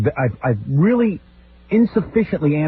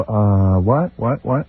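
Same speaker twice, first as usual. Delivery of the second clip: acting dizzy; 5 semitones lower, much slower than usual. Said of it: "I act real dizzy".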